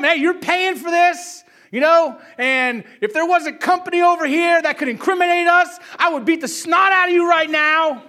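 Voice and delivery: scare him with every word; threatening shout. Retold or imitated. Dad threatens son over the ruined spray, loud shout. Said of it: "so we told him".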